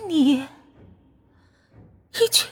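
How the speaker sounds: high, slow, sobbing ghost voice; ghostly wail. Acted. Murdered ghost girl accusing her killer.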